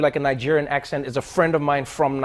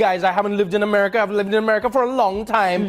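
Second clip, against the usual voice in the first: put-on Trinidadian accent; 6 semitones higher than usual. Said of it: "then he's like".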